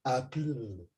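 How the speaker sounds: soft, hammed-up posh "apple"; mock posh accent. Host joking about saying apple in a posh accent, mock posh. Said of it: "say apple in a posh accent".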